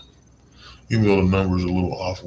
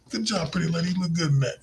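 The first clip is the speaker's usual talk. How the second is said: much higher playful compliment; high flirty praise; 11 semitones higher, faster than usual. Shown as a demonstration. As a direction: charm her with a bright compliment. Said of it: "You know what I'm saying".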